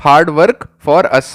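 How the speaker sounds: hard work for us drawn out very slowly; slow memory-trick drill; slow spelled-out trick. Shown as a demonstration. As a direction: lay out hard work for us one slow word at a time. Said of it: "तो trick क्या हुई".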